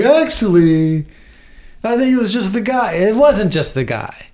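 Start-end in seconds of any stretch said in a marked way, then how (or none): 0.0-2.9 mock excuse. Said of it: "where you're like".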